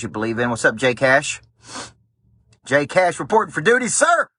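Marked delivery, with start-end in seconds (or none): 2.6-4.3 high snappy military report. Retold or imitated. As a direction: sound off like a recruit to his officer. Reading viewer's duty report aloud.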